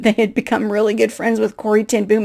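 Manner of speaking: ordinary delivery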